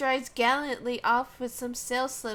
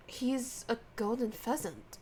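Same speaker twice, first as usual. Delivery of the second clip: normal talking voice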